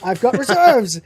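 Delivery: hammed-up nervous brag; boasting Jim. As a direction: insist like a desperate boaster. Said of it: "and he goes".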